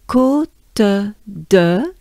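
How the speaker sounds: côte de said slowly; French côte de. Shown as a demonstration. Teacher saying côte de with the class. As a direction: say côte de with a held O. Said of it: "let's do it together".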